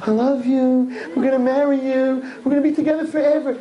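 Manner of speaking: hammed-up lover; mock romantic promise. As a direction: lay on the love vows. Mocking boy's promises.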